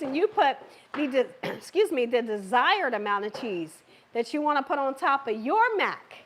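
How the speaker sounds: ordinary talk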